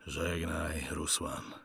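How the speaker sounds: low, soft last goodbye; father's farewell. Acted. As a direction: part from your son in a hushed voice. Father bids son farewell.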